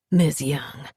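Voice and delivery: gentle Southern drawl, unhurried; a big, courtly Southern gentleman, spoken softly; soft southern accent. Acted. Big Southern gentleman greets the homeowner, soft southern accent. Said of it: "in a soft southern tongue".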